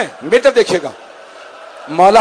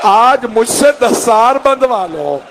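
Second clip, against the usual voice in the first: higher, drawn-out pleading lament; mournful father; 4 semitones higher, slower than usual. Retold or imitated. Father asking to tie his son's turban, mournful plea. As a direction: plead in a long, rising wail.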